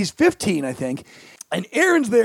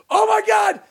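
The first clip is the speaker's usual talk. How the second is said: high-pitched shouted mock panic; imitated panicking cousin; 9 semitones higher than usual. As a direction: cry out for help, high and urgent. Voices cousin panicking over allergy.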